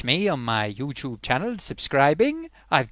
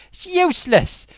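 exasperated outburst; 13 semitones higher, 5 dB louder than usual. Real, not acted. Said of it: "You know the way they make us talk".

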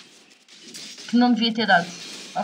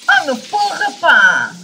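loud exasperated cursing; frustrated swearing; 5 semitones higher, 10 dB louder than usual. Real, not acted. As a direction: blurt out curses in frustration. Frustrated outburst while gaming.

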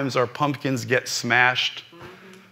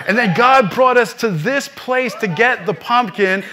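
climbing higher and louder into full preaching cry; preaching at full force; 13 semitones higher, 6 dB louder than usual. Acted. Preacher retelling his son's words to the congregation, building to a full-force preaching cry. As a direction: lift the congregation, louder and higher with each line, sometimes through a laugh.

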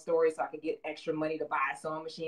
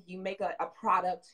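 ordinary delivery

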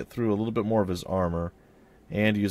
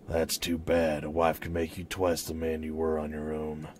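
low, subdued man's voice; hooded stranger; 5 semitones lower, 5 dB quieter than usual. Acted. Hooded man introducing himself to the Ravens, low stranger's voice. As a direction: speak low as a reserved traveler.

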